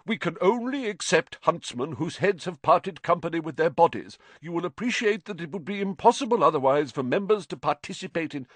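mock the letter angrily; reading furiously. A narrator's reading. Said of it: "read furiously".

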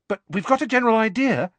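fast, high, placating rush; hasty. A narrator's reading. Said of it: "she added hastily".

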